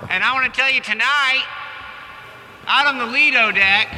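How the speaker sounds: put-on drunk captain announcement. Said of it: "My name's Captain Blowhorn".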